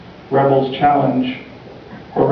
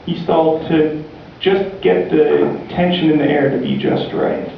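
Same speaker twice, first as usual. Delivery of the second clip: ordinary delivery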